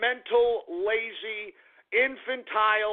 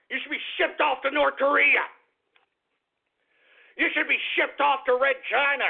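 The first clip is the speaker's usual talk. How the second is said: higher-pitched, exasperated outburst; angry rant; 5 semitones higher than usual. Real, not acted. Host telling opponents to be deported, angry rant. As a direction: rail at them, voice pushed up in anger.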